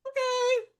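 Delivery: squeaky high drawn okay; a hammed-up squeak of dread; mock-nervous okay. Retold reaction, much higher.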